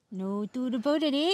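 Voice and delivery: put-on light Canadian accent with a tag eh. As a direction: play it up Canadian, eh. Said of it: "could you do nothing but talk in a Canadian accent".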